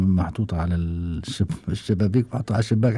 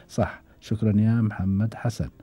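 ordinary talk, nothing marked

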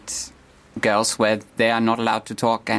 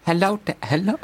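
high, halting posh English hello; 9 semitones higher, faster than usual. Shown as a demonstration. [name] practising his David voice, high posh English accent. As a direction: try out a posh English greeting. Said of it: "sound like a posh English person".

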